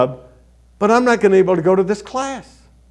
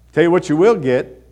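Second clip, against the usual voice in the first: normal talking voice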